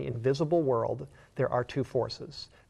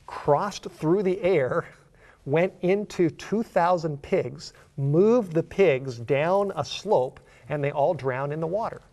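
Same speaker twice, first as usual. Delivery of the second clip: slow, loud, higher preaching climax; emphatic preaching; 4 semitones higher, 5 dB louder, slower than usual. Real, not acted. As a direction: hammer each step of the story out slowly.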